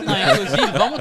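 laughing burst; words squeezed out through laughter. Real, not acted. Laughing reaction.

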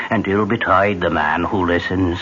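ordinary talk, nothing marked

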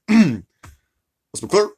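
halting presidential impression with long pauses; measured statesman, stopping and starting on fillers; stately, hesitant politician cadence; Barack Obama. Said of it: "I could do an Obama accent".

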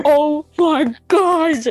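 drawn-out shocked oh my gosh; shocked gasp. Retold or imitated. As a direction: stretch it out, stunned. Shocked reaction retold.

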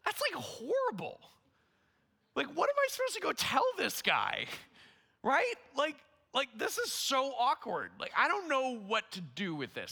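high, exasperated outburst, acted out; dismayed protest. Man balks at giving a friend an awkward word, exasperated. Retells his panicked protest to himself.

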